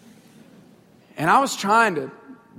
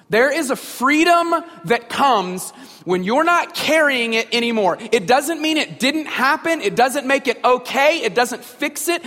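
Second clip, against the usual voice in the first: rising, louder preaching build; 4 semitones higher, 4 dB louder than usual. Acted. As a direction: drive each line harder like a pastor at the pulpit. Preaching builds through repeated I've.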